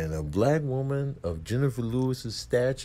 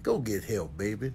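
caring; 2 semitones lower, 6 dB quieter than usual. Retold or imitated. Imitating a scolding woman telling [name] to get help, caring. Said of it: "but some care on the end".

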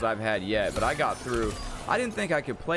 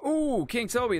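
a high, delighted ooh; light up at the idea; excited ooh; 12 semitones higher than usual. Real, not acted. Excited reaction to chat idea.

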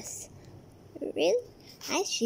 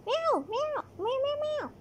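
cat meows; 12 semitones higher than usual. Acted. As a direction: meow like a little cat. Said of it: "You can speak cat?".

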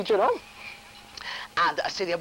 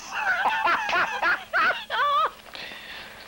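high delighted shriek of laughter. Real, not acted. Punchline of her story.

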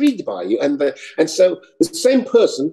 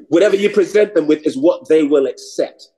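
normal talking voice

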